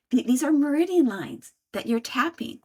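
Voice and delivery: ordinary delivery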